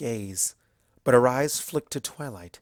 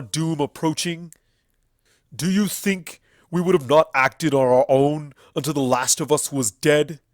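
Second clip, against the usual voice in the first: furious stallion accuser; 4 semitones higher, 9 dB louder than usual. Acted. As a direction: condemn the tyrant with contempt.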